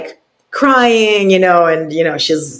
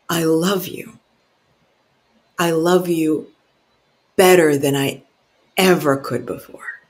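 tender declaration; 2 semitones lower, 4 dB quieter than usual. Retold or imitated. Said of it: "he looked at her and he said".

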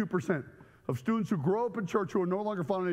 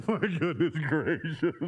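laughing; 5 semitones lower, slower than usual. Real, not acted. Cracking up at his own story.

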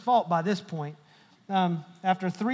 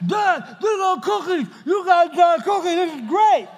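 put-on excited kid voice; 9 semitones higher, 8 dB louder than usual.

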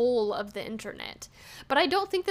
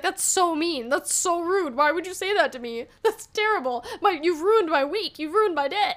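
hammed-up hurt whine, a touch higher; mock whiny crying; 5 semitones higher than usual. Imagining a rude stranger in a store, mock tearful whine. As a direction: pretend to be wounded, half laughing. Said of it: "I'd probably start crying".

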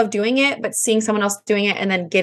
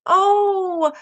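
put-on higher, gentle mom voice to a child; tender mom; 7 semitones higher than usual. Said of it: "And I was like".

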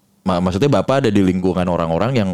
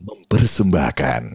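put-on old-style calm announcer; 5 semitones lower, slower than usual. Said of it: "suaranya kalem gitu ya".

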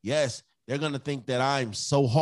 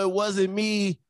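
higher voice imitating a child's excuse; kids' denial; 7 semitones higher, 4 dB louder than usual. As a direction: lightly mimic a kid dodging blame. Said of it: "the first thing they'll say".

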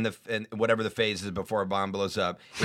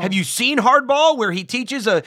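exasperated outburst; 10 semitones higher, 4 dB louder than usual. Retold or imitated. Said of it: "and I'm like".

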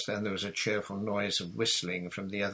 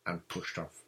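usual speaking voice

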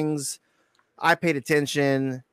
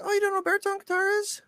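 mock teasing disbelief; 4 dB quieter, faster than usual. Teasing Tom about baritone.

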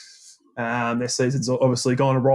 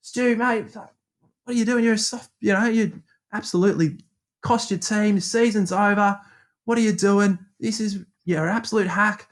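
higher, taunting jeers at the batsman; a cricketer sledging a batsman, higher and jeering; mocking sledge; 7 semitones higher than usual. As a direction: needle him, mock-matey and higher. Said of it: "Going at him".

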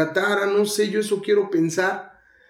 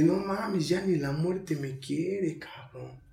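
baja la voz, lento y desanimado; murmullo derrotado; 2 semitones lower, 10 dB quieter, slower than usual. Retold or imitated. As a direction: se lo dice a sí mismo, vencido. Se dice a sí mismo, derrotado. (murmullo derrotado en voz baja)

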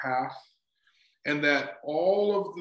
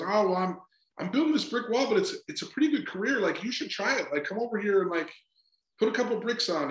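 a lightly voiced eager worker, pitched higher; cheerful bricklayer; 6 semitones higher than usual. Speaker voicing a keen bricklayer in a parable, lightly higher and eager. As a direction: sell the job like a happy tradesman.

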